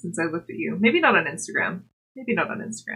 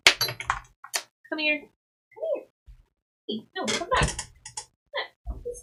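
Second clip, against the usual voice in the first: calling the cat; 10 semitones higher, 5 dB quieter, slower than usual. Real, not acted.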